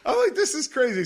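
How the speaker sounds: building outrage with laughs breaking through; worked-up indignant rant. Real, not acted. Platinum flyer venting to his co-host, high laughing indignation.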